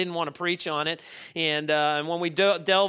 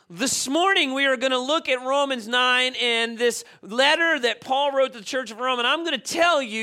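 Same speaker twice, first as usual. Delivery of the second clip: raised, emphatic preaching voice, higher and driving; fervent preaching; 6 semitones higher, 3 dB louder than usual. Acted. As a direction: preach it to the congregation with full conviction. Preaching at full force.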